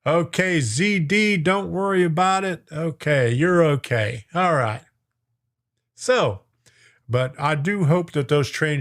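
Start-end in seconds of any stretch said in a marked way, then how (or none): none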